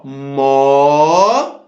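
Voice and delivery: "Mó" held long on a low, slowly rising note; teacher sounding out "Mó" as a single drawn-out syllable; isolated syllable. Shown as a demonstration. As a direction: stretch "Mó" out on its own. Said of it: "Esse mó e o lhá".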